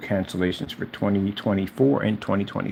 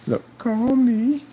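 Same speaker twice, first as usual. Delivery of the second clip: high, slow pleading voice, mocking the seller; put-on pleading voice, high and slow; mocking whiny plea; much slower than usual. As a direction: drag out a whiny call me, much higher. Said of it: "Tell me".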